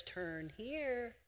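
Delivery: soft, calm instruction from the voice of the Lord; quiet guiding voice. Retold or imitated. Preacher gives God's voice guiding the listener, soft and quiet. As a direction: say it softly, like a gentle inner voice. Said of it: "then the voice comes and says".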